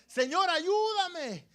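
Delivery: súplica gritada, aguda y lenta; clamor a Dios. Acted. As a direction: grita pidiendo auxilio al Señor. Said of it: "gritarle a Dios". (grito de súplica a Dios)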